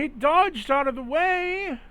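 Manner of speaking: high, loud anguished yelling, slow; furious pained scream. Acted. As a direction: scream it in outrage. Bandit shot in the eye.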